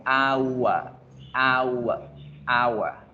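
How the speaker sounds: "Agua" as "AU-uh", three vowels gliding, no g; triphthong. Shown as a demonstration. Teacher modelling for learners, "hour" as a falling "au-uh". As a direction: stretch English "hour" through ah, oo, schwa. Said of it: "se pronuncia con un triptongo".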